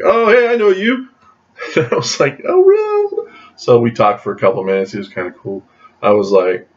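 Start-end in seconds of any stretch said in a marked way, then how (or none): 0.0-1.1 high-pitched imitated greeting; delighted recognition. Retold recognition by the actor. 2.5-3.3 very high squeaky mock reply; starstruck. Retold starstruck reply to actor.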